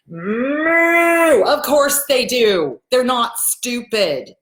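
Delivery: loud, slow, emphatic rebuttal; exasperated retort. Acted. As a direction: bellow it, then drag each word out. Acted retort to arguing parents.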